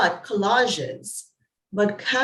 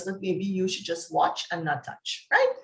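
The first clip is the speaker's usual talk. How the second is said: usual speaking voice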